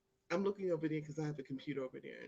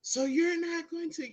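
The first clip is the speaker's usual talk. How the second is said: mock guard yell; 11 semitones higher, 7 dB louder than usual. Retold guard yelling again.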